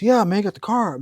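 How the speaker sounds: put-on high, loud kid's protest; mock indignation. Acts out protesting Gen Z kid.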